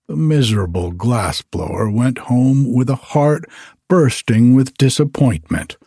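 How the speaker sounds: plain reading voice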